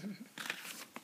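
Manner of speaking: a quick breathy giggle; soft laugh. Real, not acted. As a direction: laugh lightly under the breath. Reader laughs at baby food.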